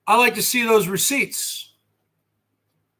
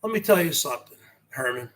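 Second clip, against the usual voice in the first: soft, low confidential delivery; quiet talking; 8 semitones lower, 7 dB quieter, much faster than usual. Shown as a demonstration. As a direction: drop the voice low and soft for a demonstration. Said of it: "I can say".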